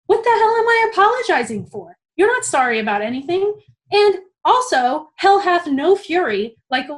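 a student's inner outrage at her supervisor, relived loudly; retold rage. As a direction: let the anger break through the apology. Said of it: "I am so pissed in this moment".

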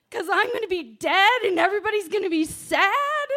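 building from normal to a high, loud rant; frantic outburst. Retold or imitated. Trapped under the raft, panicking she'll ruin the party: rising frantic rant. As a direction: work yourself up until you're yelling about the party.

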